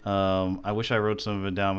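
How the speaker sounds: normal talking voice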